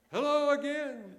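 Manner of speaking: slow, loud, high holler; shouted greeting. Acted. Preacher demonstrating shouting it out, drawn-out shout. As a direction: stretch the call across a canyon. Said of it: "We shout it out".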